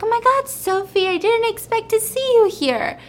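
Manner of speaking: high-pitched startled greeting; feigned surprise. Retold or imitated. Woman caught in a towel greets the girlfriend, high surprise.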